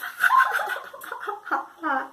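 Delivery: a big bright laugh on cue; demo laugh. Shown as a demonstration. Teacher showing children how yellow makes you laugh. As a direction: laugh out loud to show happy. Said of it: "it makes you laugh".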